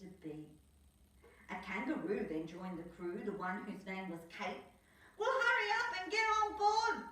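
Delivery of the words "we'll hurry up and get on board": high, louder urgent voice; hurrying Skip. Acted. Skip hurrying the crew, high loud urgent voice. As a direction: urge the crew on board. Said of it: "said Skip".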